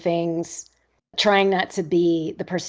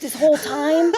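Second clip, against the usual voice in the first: laughing disbelief; 8 semitones higher, 4 dB louder than usual. Retold or imitated. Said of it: "She's like".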